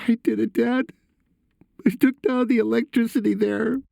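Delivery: very high, shaken son's confession; shaking voice. A narrator's reading. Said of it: "he said, his voice shaking".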